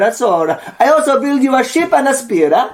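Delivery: mock Italian craftsman selling hair, laughing; Italian accent. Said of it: "I think he's Italian".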